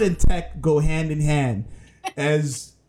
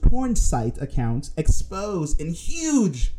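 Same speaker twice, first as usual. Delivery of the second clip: announcer voice; 5 semitones higher than usual. Pretend. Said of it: "let me try announcer voice".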